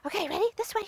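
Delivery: ordinary delivery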